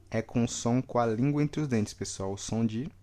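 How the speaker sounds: normal talking voice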